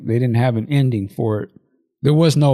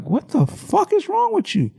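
very high-pitched mock outrage; exaggerated high retort to a screaming child; appalled reaction. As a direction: squeak it out, incredulous.

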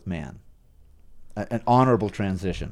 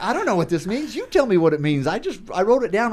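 higher-pitched, helpless and hushed; flustered pleading; 10 semitones higher than usual. Retold or imitated. To his wife in class, a higher flustered plea. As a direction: plead he has no idea. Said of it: "I'm like".

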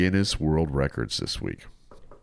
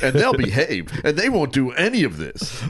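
higher, sing-song naive boasting; mock smug confidence; 8 semitones higher than usual. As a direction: act out a know-it-all parent-to-be. Acts naive future parents' boasts.

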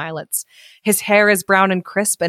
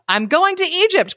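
excited cry; 11 semitones higher than usual. A narrator's reading. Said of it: "cried the swallow".

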